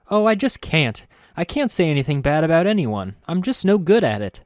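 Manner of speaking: put-on high, fretful girlish voice; flustered girl. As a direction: protest sweetly, all aflutter. Nenny flustered refusing.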